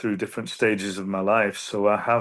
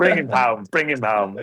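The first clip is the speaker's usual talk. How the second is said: mock Australian accent; 3 semitones higher, 4 dB louder than usual. Guest answers the host with a hammed-up mock Australian accent. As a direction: another go at the Aussie lyric, hammed up. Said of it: "I sound more like [name]".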